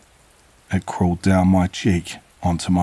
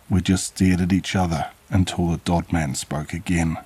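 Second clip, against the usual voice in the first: plain reading voice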